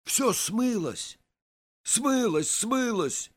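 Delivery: high, insistent exclamations, each one falling; insistent repeating. A narrator's reading. Narrator keeps telling Vaska it washed off, insisting. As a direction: hammer the word out, high-pitched. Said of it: "твержу я".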